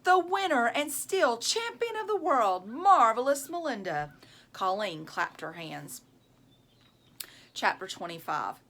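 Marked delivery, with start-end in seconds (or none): 0.0-4.1 high, loud ring announcer call; mock boxing announcer bellow. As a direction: announce the champion proudly. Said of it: "she bellowed".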